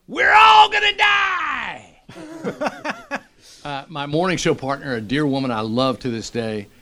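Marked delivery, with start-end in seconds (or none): 0.1-3.2 very high, slow, drawn-out yell of doom; mock panicked shout. A joking skydiver yells to the plane full of jumpers, drawn-out panic shout. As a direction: scream it like the plane is going down. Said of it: "a guy in the front goes".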